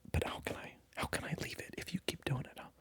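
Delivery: ordinary talk, nothing marked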